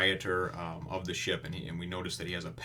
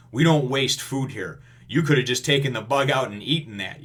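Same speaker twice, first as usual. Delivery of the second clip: higher, louder scolding with firm emphasis; stern retold rebuke; 4 semitones higher, 8 dB louder than usual. Sanji rebuking the beaten customer, stern scolding. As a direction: lay down the law, acting it out.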